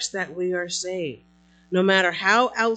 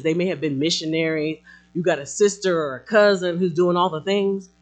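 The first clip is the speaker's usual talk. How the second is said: ordinary talk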